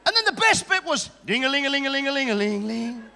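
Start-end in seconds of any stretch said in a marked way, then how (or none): 1.2-3.0 sung ling-a-ling bell jingle; imitated church bell. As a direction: ring it out like a little bell.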